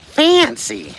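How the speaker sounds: high, loud mock-posh squeal; mock fancy. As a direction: squeal it like a snob.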